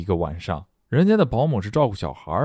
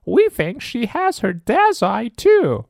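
much higher, louder, slow proud monster; 14 semitones higher, 4 dB louder, slower than usual. Acted. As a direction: gush proudly as a squeaky monster parent. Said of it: "said Blob proudly".

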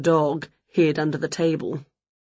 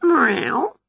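higher-voiced cat reply; cat's meow; 6 semitones higher than usual. A narrator's reading. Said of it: "said Wilbur".

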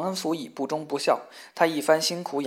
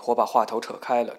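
plain reading voice

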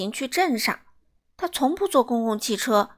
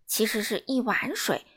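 usual speaking voice

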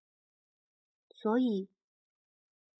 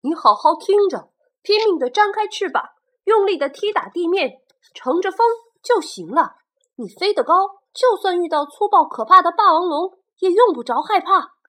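high, loud, urgent father; father's voice; 11 semitones higher, 14 dB louder than usual. Retold or imitated. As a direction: urge the child on with force. Father pterosaur's remembered advice.

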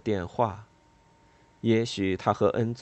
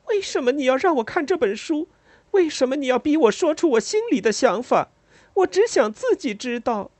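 much higher, louder harsh outburst; sharp cry; 6 dB louder than usual. A narrator's reading. Lila sharply rebukes the narrator for making her speak. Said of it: "但他厉声说".

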